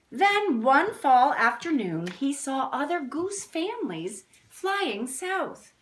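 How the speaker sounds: slow, emphatic read-aloud; storybook reading. A narrator's reading. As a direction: read it like a picture book to kids. Reads the next page.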